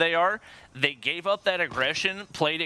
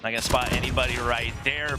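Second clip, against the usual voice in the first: ordinary talk, nothing marked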